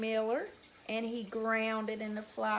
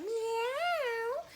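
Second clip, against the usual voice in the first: high drawn-out meow; cat's meow; 10 semitones higher than usual. Shown as a demonstration. Storyteller showing children what a cat says, high meow. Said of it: "Just like that".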